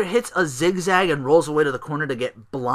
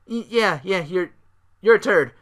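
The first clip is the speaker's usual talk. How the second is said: mock agreement; 6 semitones higher, 4 dB louder than usual. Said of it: "It's like".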